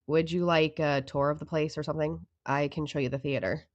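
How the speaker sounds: lightly voiced bored clerk, slowish; Amber's voice. Clerk Amber greets Mike, a hint of boredom.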